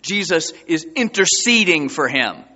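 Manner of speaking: ordinary talk, nothing marked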